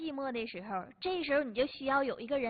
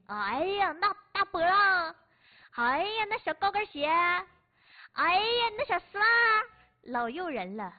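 higher, louder gasps of admiration; gushing admiration; 4 semitones higher, 4 dB louder than usual. Acted. Host describing the stylish woman in the lift, gushing higher and louder. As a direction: swoon over each detail.

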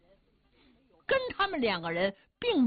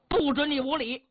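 stern rebuke; 6 semitones higher than usual. Acted. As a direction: snap at the younger brother to stop. 贾福喝止兄弟. (厉声呵斥)

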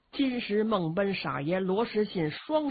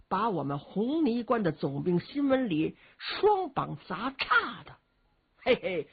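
ordinary delivery

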